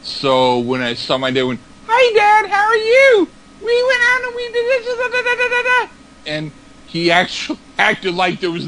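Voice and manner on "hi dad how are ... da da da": very high squeaky put-on voice; much higher squeaky voice; talking weird. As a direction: chirp at your dad in a silly high voice. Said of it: "I just wanted to talk weird".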